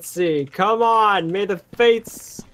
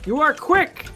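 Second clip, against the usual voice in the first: normal talking voice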